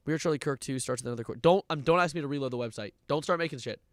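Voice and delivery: ordinary talk, nothing marked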